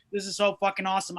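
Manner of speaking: ordinary talk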